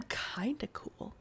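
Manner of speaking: whispered admission. Real, not acted. Teen admits to the kid he's cool, whispered. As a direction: whisper it half to yourself. Embarrassed aside.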